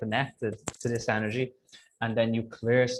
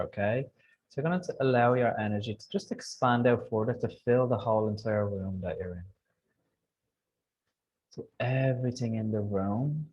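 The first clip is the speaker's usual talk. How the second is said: guided meditation. Acted. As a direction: gently guide them through visualization.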